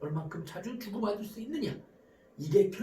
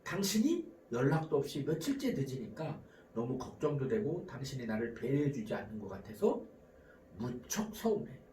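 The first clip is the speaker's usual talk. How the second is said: lower, softer voice of hurt concern; calm factual appeal; 8 semitones lower, 6 dB quieter than usual. Shown as a demonstration. Worried spouse to a late partner, calm and hurt. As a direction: model a gentle, non-attacking complaint. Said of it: "자, 이렇게 대화해보세요".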